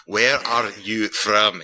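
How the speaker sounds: very slow, word by word for a foreigner; slowed right down. Retold or imitated. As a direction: spell it out at half speed. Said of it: "let me slow this down".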